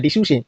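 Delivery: "ডিসুসে" said as di-shu-she, final e sounded; wrong letter-by-letter reading. Shown as a demonstration. Teacher showing the mistake to avoid, "ডিসুসে" as di-shu-she. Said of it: "এই যেন ভুল না হয়".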